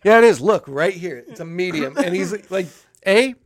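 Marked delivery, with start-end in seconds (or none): 0.0-1.9 loud mock argument; insistent arguing. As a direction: imitate a brother insisting he's right. Brother insists in acted quarrel.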